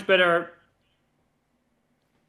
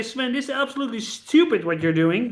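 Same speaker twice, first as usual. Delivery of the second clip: hammed-up angry partner, rising higher and louder; mock tirade from an honest partner; mock scolding; 5 semitones higher, 4 dB louder than usual. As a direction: scold him like a fed-up teammate. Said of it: "I want you to say".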